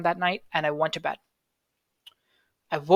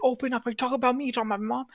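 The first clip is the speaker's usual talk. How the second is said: higher, accusing demand through the door; retold suspicious roommate, pitched up; imitating angry roommate; 7 semitones higher, much faster than usual. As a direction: play the paranoid roommate pounding on the door.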